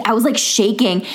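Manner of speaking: normal talking voice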